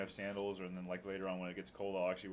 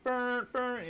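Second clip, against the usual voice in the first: much higher, louder strained chant at the light; high mock urging; 9 dB louder than usual. Stuck behind a slow driver at a green light, high yelled chant. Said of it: "and then I'm like".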